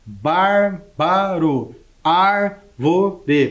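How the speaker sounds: each word drawn out slowly, stress on the antepenult; slow syllable-stressed list. Shown as a demonstration. Teacher reading examples to the class, each slowly drawn out. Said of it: "Percebeu ali?".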